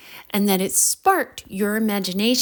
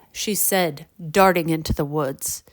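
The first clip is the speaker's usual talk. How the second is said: usual speaking voice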